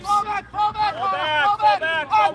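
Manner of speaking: loud repeated command; shouting orders over battle. Real, not acted. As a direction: bark the retreat order.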